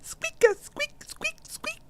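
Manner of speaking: tiny high mouse squeaks; rubbery high squeaky toy. Pretend. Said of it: "That's what I would say if I was a mouse".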